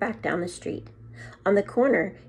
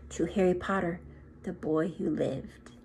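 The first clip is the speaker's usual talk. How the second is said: hushed toast; 6 dB quieter than usual. A narrator's reading. Secret gatherers toast Harry in hushed voices. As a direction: raise a glass and say it hushed. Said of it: "saying in hushed voices".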